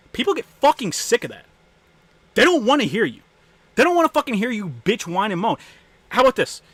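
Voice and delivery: real fed-up yelling; angry rant. Real, not acted. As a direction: rail at the whiners.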